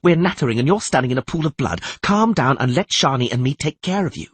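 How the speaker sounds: ordinary delivery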